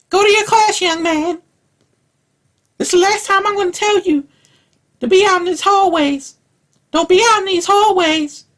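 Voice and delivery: put-on high, loud old schoolteacher scolding a boy; old teacher scolding. Stand-up comic imitating an old teacher scolding a boy in the hallway, high and loud. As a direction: screech it like a strict old teacher. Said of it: "Miss Brown would be like".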